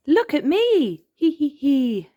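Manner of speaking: little witch's cackle; very high, loud call into a giggly cackle; Peppa. A narrator's reading. Child dressed as a witch, cackling with glee. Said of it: "cackled Peppa".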